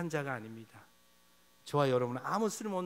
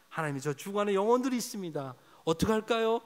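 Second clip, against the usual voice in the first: louder, higher cry of alarm; 6 semitones higher, 5 dB louder than usual. Pretend. Preacher praying for the church, crying out loud. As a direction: cry it out to God. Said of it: "하나님, 탄식하기 원합니다".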